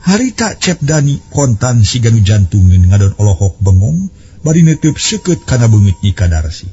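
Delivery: usual speaking voice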